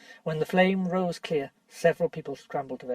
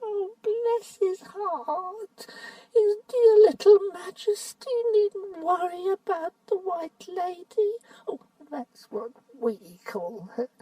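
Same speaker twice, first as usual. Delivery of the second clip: much higher, slow, wheedling whine; shrill whining voice; 12 semitones higher, slower than usual. Acted. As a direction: fawn and whimper like a crone. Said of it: "the shrill whining voice began".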